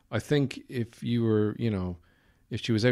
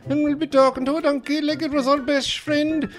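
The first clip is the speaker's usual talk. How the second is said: very high, loud stage-Irish rant; hammed-up Irish outburst, building to an indignant yell; ranting Irish character; 7 dB louder than usual. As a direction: rave in an over-the-top brogue. Spoof trailer builds into Irish rant.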